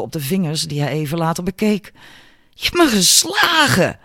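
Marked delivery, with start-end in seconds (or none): 2.5-4.0 high, loud indignant outcry; outraged cry. A narrator's reading. Albert accuses Julia of hitting him, outraged cry. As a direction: exclaim in shocked outrage, pitched right up. Struck bully cries out.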